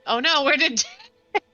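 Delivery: high, loud cry breaking into laughter; laughing dismay. Real, not acted. Player losing a companion on the map, laughing in dismay. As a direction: lose it laughing mid-question.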